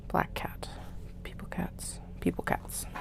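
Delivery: whispered sorting to herself; whispering. Real, not acted. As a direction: murmur the list, unvoiced whisper. Whispers while sorting pieces.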